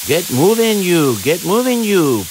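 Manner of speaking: loud, high, forceful ordering; puffing exertion. A narrator's reading. Engine pushing freight cars from behind, puffing. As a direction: shove with every phrase, puffing. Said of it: "puffed Douglas from behind".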